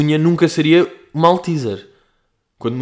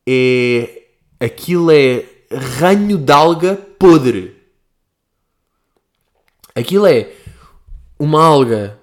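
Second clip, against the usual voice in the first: louder slow disgusted outburst; 6 dB louder, slower than usual. Real, not acted. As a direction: spit the words out in disgust. Disgusted outburst about shiitake.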